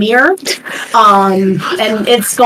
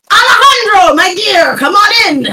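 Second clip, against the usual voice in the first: hammed-up reporter welcoming his sidekick in; grand welcome; 8 semitones higher than usual. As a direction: throw the door open with theatrical welcome. Lance greets his friend warmly.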